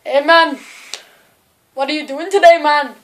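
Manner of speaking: loud put-on Jamaican accent; playful attempt at a Jamaican accent. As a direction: greet loudly in a mock Jamaican accent. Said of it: "It was Jamaican".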